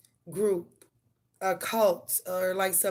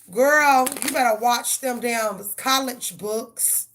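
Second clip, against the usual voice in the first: scolding; 3 semitones higher, 7 dB louder, slower than usual. Retold or imitated. Mother telling daughter to hit the books, scolding. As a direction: stretch it out loud to shut down the idea.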